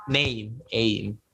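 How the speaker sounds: normal talking voice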